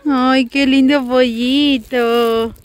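slow, drawn-out cooing. Real, not acted. Mother coos to toddler about chick.

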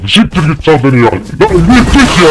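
high, loud burst of frustration; exasperated yell. Real, not acted. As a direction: yell it, drawing out the last words. Fed up, yelling at game.

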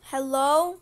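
loud, rising call; teacher calling for the missing boy, loudly. Acted. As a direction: call out into the empty room. Calling into bathroom stalls.

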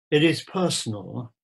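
usual speaking voice

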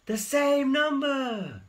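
high, loud and slow spider shout; excited shout. A narrator's reading. Spinderella shouts her discovery to the class, high and loud. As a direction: cry it out high with delight. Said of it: "shouted Spinderella".

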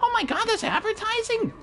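very high, mock-dumb squeak; squeaky mock voice of a confused chatter; mocking clueless viewer. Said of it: "a lot of people are like".

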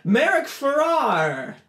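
very slow, high, loud name announcement; calling out. Acted. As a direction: stretch the name across the whole hall. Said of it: "He, like, calls".

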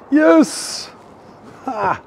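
a high, triumphant yes; excited. Real, not acted. Vlogger finding the bin he predicted, high triumphant yes. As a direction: cheer at being right. Finds the garbage bin he guessed.

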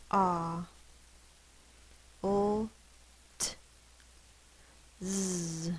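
"Ah. L, t, z" as isolated sounds with long pauses; sounded out. Shown as a demonstration. Teacher finishing waltz, "Ah. L, t, z" one sound at a time. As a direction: hold "Ah", "L" and a buzzing "z", tap the "t". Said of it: "Sound it out".

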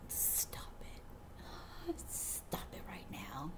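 hushed playful "stop it"; whispered scolding. Acted. Reader whispering at a cute card, playful scold.

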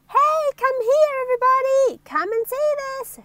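very high, loud call to a crowd; top-of-the-voice shout. Acted. Little Miss Trouble summoning everybody to see, loud shout. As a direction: holler it out, pitch sky-high. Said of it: "she shouted at the top of her voice".

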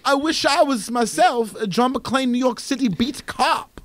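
hammed-up European fan introducing himself; Rennie Harlan. Director wishing he were John McClane, European accent. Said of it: "I'll just do a European accent".

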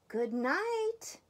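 high good night, rising at the end. A narrator's reading. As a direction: call it out to be heard. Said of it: "Mouse called to it a little louder".

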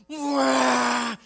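loud, high blaring "wah"; long honking yell; truck horn imitation. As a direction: blast it like a monster truck horn. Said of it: "lays on the horn".

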